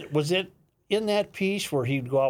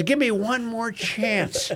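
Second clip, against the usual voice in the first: higher, slow pleading; pleading guy; 10 semitones higher, slower than usual. Retold or imitated. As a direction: beg for one more go, slowly. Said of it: "Guy says".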